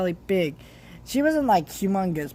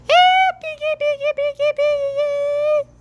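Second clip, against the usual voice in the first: very high sing-song call, drawn out slowly; calling the pig; 6 dB louder than usual. Retold or imitated. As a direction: call a pig from across the yard, high and stretched.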